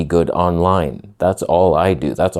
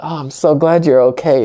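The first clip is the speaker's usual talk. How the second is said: high and a bit louder, sugary fake relief; mock relief; 7 semitones higher, 4 dB louder than usual. Teasing fiance on the phone call, hammed-up sweet relief. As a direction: ham up the gladness. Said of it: "I was just pretending".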